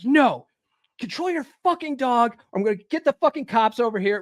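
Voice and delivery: high, heated retort that speeds up; retold losing it. Man threatening neighbor over her dog, retold angry outburst. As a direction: re-enact losing your temper at the neighbor. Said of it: "lost it even more".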